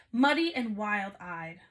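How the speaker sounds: plain reading voice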